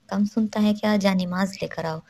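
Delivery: ordinary delivery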